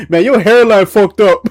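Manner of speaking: cracking up while roasting a buddy; laughing roast. Real, not acted. Roasting a friend's hairline, loud and laughing.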